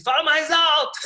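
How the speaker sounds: retold student shout. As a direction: call out like an impatient student. Students yelling at the guide.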